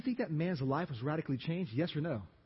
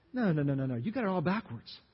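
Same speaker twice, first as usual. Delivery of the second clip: normal talking voice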